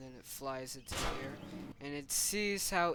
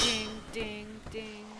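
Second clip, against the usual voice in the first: high, ringing ding sounds; imitated dinging; 6 semitones higher, slower than usual. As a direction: chime it out on one high note.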